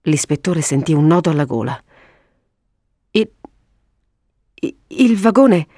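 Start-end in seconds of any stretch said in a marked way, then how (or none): 3.1-5.7 halting stammer, higher pitch. A narrator's reading. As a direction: stumble over the first word with long gaps. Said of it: "balbettò".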